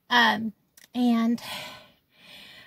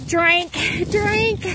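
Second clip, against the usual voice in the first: breathless plea; 8 semitones higher, 4 dB louder than usual. Retold or imitated. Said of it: "All I could get out was".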